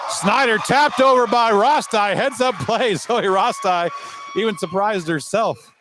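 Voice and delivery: high, loud burst of excitement with a laugh; excited shout. Real, not acted. Announcer thrilled by a surprise tap-over, high excited shout. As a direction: shout it out like the crowd just jumped up. Heads-up play, announcer erupts.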